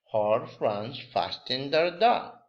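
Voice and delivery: normal talking voice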